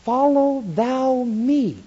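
slow, drawn-out Follow thou me; slow firm reminder; gentle rebuke. Acted. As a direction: slowly tap it in. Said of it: "tap him on the head and say".

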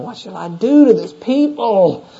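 high-pitched exasperated Moses crying out; grumbling complaint. Acted. Moses cries out to the Lord about the people, exasperated grumbling. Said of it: "he began to grumble at the Lord".

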